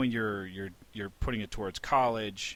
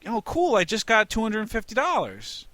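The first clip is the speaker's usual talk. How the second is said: put-on excited kid; 10 semitones higher, 5 dB louder than usual. Child voiced receiving savings bond.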